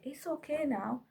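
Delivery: high, soft reassurance; soothing. Retold or imitated. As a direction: comfort a crying child gently. Soothing her crying son, retold.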